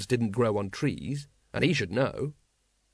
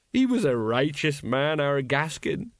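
solemn eulogy; slower than usual. Acted. Colon eulogising fallen comrade.

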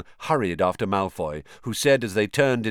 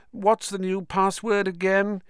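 higher, offhand and impatient; sneering boy voice; 10 semitones higher than usual. A narrator's reading. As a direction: careless superior schoolboy. Malfoy casual, impatient.

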